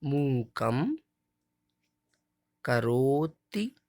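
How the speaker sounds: each word slowly separated, वाचालम held long; slow model recitation. Shown as a demonstration. Teacher reciting each word slowly for children to repeat. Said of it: "एक बार और फिर से देखते हैं इसी line को".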